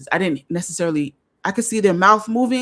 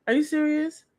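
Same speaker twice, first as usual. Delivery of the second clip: shocked; 10 semitones higher than usual. Real, not acted. Shocked reaction to comment.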